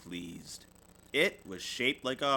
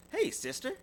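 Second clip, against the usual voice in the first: high, bright call; friendly Lizzie; 14 semitones higher than usual. Acted. Lizzie coming up to Sister at recess, high call. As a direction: call her over. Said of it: "said Lizzie".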